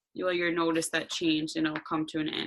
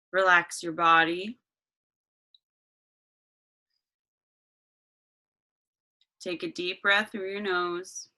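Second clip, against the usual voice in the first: guided meditation. Acted.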